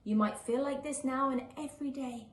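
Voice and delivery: gentle, lilting picture-book read-aloud; reading to children. A narrator's reading. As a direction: read it like a bedtime story for little ones.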